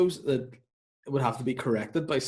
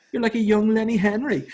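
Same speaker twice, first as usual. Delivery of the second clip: high gushing judge impression; Louis Walsh; 8 semitones higher, 3 dB louder than usual. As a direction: proclaim the compliment in a raised put-on voice. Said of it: "Louis says the line to him".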